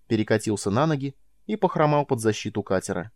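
usual speaking voice

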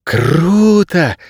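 high, drawn-out "wow" exclamation; sincere admiration. A narrator's reading. Girl impressed by the dog's work, admiring. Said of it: "искренне восхитилась Кейса".

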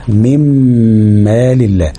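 من مال الله with the n turned into a held nasal m; full ghunna. Shown as a demonstration. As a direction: hum a long m joining من to مال الله. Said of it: "وادي لها الغنة بتاعتها".

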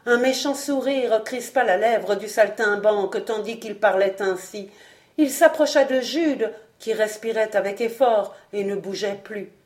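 usual speaking voice

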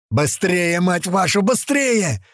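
high shout climbing to the last word; urgent yelling. A narrator's reading. Machine gunner hurrying his mates, urgent yell. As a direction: hurry them on, pitch rising as you go. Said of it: "орёт Нема".

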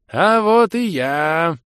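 put-on high, slow, toothless old voice; lisping old man. A narrator's reading. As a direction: gum the words like a happy old geezer. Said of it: "радостно прошамкал старый лохр".

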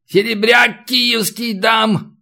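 high, slow, stretched-out holler; old haggler's drawn-out high yell; yelled offer. A narrator's reading. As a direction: shout a bid up to the window. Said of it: "заорал Натаниэль".

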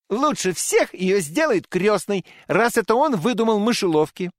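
put-on high, loud boyish shout; Fritz shouting. A narrator's reading. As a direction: shout it out like an eager boy. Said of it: "вскричал Фриц".

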